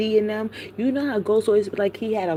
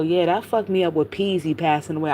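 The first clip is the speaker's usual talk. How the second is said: ordinary talk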